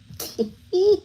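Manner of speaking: sudden high laugh; real high-pitched laugh; high burst of laughter. Real, not acted. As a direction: laugh out loud, high and sharp. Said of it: "You know, I'm laughing".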